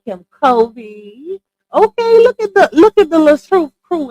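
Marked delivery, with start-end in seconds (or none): 1.7-4.1 high, loud hype greeting; excited shout-out. Real, not acted. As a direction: hype the crew up as they arrive. Greeting her viewers' crew.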